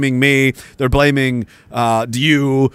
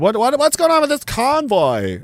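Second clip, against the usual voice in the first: high, excitable mock questioner; excited onlookers; 10 semitones higher than usual. People asking the veterans about the convoy, excited and high-pitched. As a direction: imitate the public, pitched way up.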